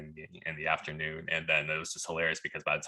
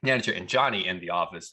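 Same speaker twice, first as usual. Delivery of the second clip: ordinary delivery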